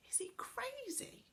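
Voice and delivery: breathless whispered aside. Acted. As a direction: lean in and whisper it to Joe. Connie whispers to Joe.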